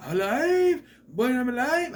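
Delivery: high, loud, slow hammed-up cry of joy; mock a wooden boy thrilled to be alive; ecstatic puppet. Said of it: "It was just".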